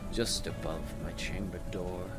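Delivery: plain reading voice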